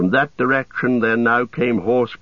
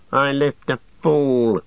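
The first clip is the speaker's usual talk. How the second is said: higher, slow snarl; 5 semitones higher than usual. A narrator's reading. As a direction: snarl it out with contempt. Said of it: "snarled Grishnak".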